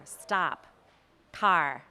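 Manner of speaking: "stop", "car" with flat, open American vowels. Shown as a demonstration. Coach demonstrating American accent, slow flat "stop", "car". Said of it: "the American accent is flat".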